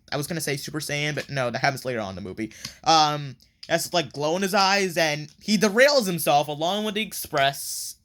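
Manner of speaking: ordinary talk, nothing marked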